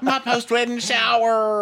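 high, slow bridal squeal; mocking Brittany. Friend mocks Brittany's post-wedding parties in a high squeal. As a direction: squeal it out like a giddy bride. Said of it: "Is Brittany still having parties".

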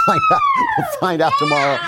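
high, loud laughing yell; a shrieking laugh of glee; laughing shout. Real, not acted. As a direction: whoop it out through a laugh. Laughing shout about mailbag.

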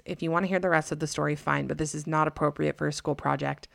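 plain reading voice

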